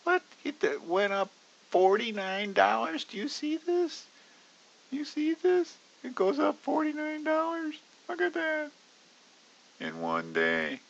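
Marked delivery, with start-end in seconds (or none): 0.0-8.7 hammed-up, much higher squeal, climbing higher to a shrieking peak; retold panic. Trader reliving his shock at the chart, squeaky mock panic. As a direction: replay the shock at the screen as a cartoon squeak. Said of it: "and I went".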